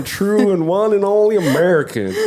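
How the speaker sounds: high-pitched comic character; made-up silly voice. Acted. Introducing self to newcomers, high silly voice. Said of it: "I just make up voices".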